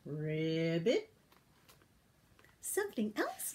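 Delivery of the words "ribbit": deep croaking ribbit; low frog croak. Acted. The frog says ribbit in a low croak.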